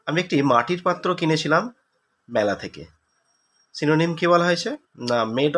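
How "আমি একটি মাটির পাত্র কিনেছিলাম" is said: usual speaking voice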